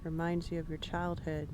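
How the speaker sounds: calm, unhurried guiding voice with long pauses; guided meditation. Acted. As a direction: ease listeners into the grass image. Leads a shared guided meditation.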